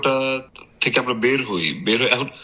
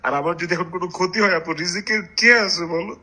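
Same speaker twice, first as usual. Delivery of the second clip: crying on the phone; 8 semitones higher than usual. Real, not acted. Distraught husband pleading on phone.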